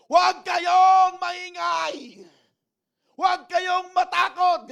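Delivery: high, drawn-out shout; Moses shouting. Acted. Moses silencing the complaining people, long loud shout. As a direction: bellow it over a noisy crowd.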